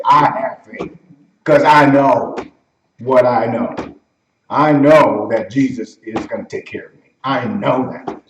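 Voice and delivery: low, emphatic declaration of faith; sure conviction. Acted. Believer sure Jesus will take care of him, firm conviction. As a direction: state it low and certain, leaning on "know". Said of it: "You hear the way they say it?".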